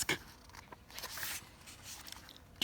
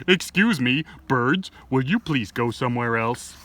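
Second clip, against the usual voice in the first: plain reading voice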